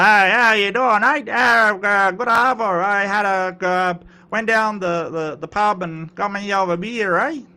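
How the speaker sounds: full Ocker accent. Shown as a demonstration. Streamer demonstrating slang Australian for viewers, full Ocker accent. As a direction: run it together in broad, hard-to-follow Ocker. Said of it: "I can go full Ocker".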